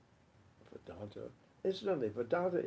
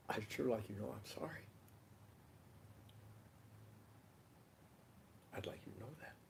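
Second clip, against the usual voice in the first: soft, lowered apology with long pauses; sincere apology; 2 semitones lower, 8 dB quieter than usual. Acted. Asking forgiveness for last year's hurts, soft and sincere. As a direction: apologize gently, fading to very quiet. Models a tender apology before a hug.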